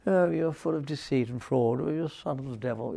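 soft, limp, prissy rebuke; mock sweet talk. Shown as a demonstration. Paul imagined rebuking Elymas timidly, soft and limp. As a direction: say it too gently, like weak sweet talk. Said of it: "Can't imagine Paul saying".

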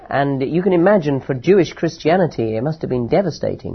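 usual speaking voice

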